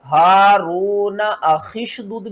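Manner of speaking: slow, measured tajweed reading; Quran recitation demo. Shown as a demonstration. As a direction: recite it slowly, syllable by syllable. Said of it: "किस तरह पढ़ेंगे". (धीमी तजवीद वाली क़िराअत)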